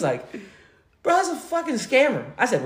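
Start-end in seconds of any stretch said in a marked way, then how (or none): 1.0-2.2 high-pitched, unimpressed brother voice; dismissive brother. Retold or imitated. Brother telling him it's a scammer, dismissive.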